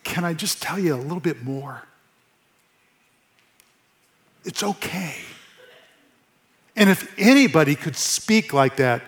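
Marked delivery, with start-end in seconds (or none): 0.0-5.2 soft and much quieter, kindly; hushed, tender "it's okay"; gentle reassurance. Retold or imitated. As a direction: comfort someone who is ashamed. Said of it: "it's if he's saying".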